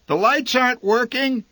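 mother calling out, high and loud. A narrator's reading. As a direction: call it across the rain from the cabin. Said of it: "she called out into the rain".

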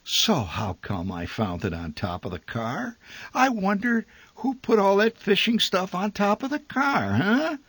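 high, mocking rising questions; sarcastic father. A narrator's reading. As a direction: needle the boy about the forgotten gear. Father sarcastic about the tackle.

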